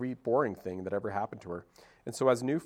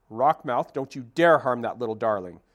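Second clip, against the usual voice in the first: loud, furious warning to the fish; an old goose scolding a predator, loud; fierce scolding; 10 dB louder than usual. Acted. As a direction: bark at the bully to back off. Old goose rescues gosling.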